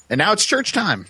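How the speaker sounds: high sing-song announcement; announcer. Acted. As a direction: announce it like a show segment. Announcing the next chapter.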